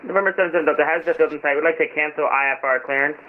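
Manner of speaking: loud radio-filtered pilot call; pilot over the radio. Real, not acted. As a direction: pilot speaking through a headset radio. Pilot calls controller over radio.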